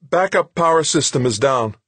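loud, high, alarmed report; high, quavering technician's voice. A narrator's reading. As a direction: report a failure in a rising panic. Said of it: "his voice high and quavering".

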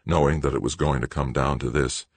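usual speaking voice

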